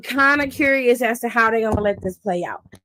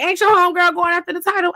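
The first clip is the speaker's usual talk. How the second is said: much higher and fast; mocking question; 7 semitones higher, faster than usual. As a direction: needle her quickly, pitched up. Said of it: "She was like".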